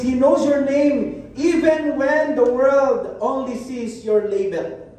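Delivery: drawn-out, deliberate sermon line; slow down and let it sink in; slow, weighty pastoral delivery; slow emphatic preaching. Acted. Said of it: "slow sermon emphasis".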